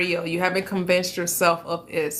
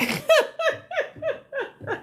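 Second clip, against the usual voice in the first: high laugh. Real, not acted. Laughing at Mariah's request.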